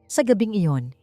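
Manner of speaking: usual speaking voice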